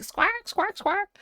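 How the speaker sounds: high, squawking bird cries; bird squawk imitation. Imitating the Lethal Company bird's high squawk. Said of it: "It was like".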